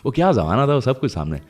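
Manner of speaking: normal talking voice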